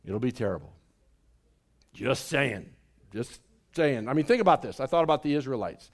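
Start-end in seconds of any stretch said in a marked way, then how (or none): 0.0-4.1 much quieter and lower, darkly; ominous hush. Acted. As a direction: drop it low like a warning. Ominous aside after good news.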